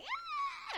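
thin, very high mock scream. Man foaming at the mouth in the synagogue, mock screaming.

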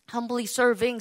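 ordinary delivery

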